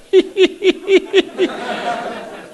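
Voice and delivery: high, imitated old man's chuckle; Eddie's laugh. Imitating Eddie laughing at the eager newcomer, high chuckle. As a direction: do Eddie's warm-hearted laugh. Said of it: "Eddie had this laugh of".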